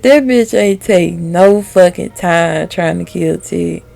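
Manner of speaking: ordinary delivery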